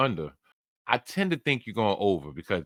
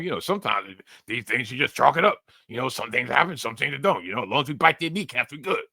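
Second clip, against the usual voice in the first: light impression of a football head coach; slightly altered coach voice, offhand philosophizing; Dan Campbell. Host jokingly channels the Lions' head coach, light impression. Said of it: "You couldn't tell that was Dan Campbell".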